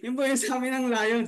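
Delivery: ordinary delivery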